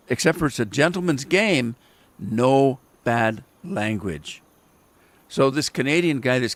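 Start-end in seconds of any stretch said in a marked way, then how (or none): 2.2-4.4 very slow, spaced words; slow emphatic. Real, not acted. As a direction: drag each word out. Stating the rule.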